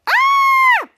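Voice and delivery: very high, very loud shriek; scream. Retold or imitated. Piper screaming as the meatball flies, all-out shriek. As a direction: let out a full piercing scream. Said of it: "And then she continued screaming".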